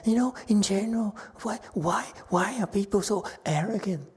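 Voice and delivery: high-pitched musing to no one in particular; mock-innocent roundabout reproach; indirect Chinese-style scolding. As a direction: wonder aloud in a high voice, aimed at nobody. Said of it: "That's Chinese for you".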